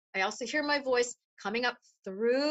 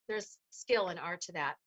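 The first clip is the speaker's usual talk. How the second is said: ordinary talk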